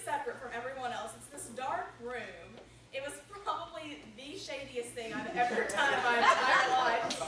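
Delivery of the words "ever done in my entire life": laughing. Real, not acted. Amused at own shady-room story.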